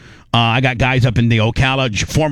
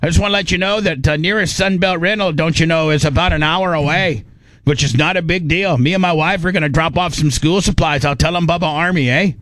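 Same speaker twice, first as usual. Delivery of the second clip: higher mock Canadian voice, don't you know; Canadian accent; 3 semitones higher than usual. To the hosts, quoting a Canadian fan in Canadian accent. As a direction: imitate a chatty Canadian from Messenger. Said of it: "the guy was like".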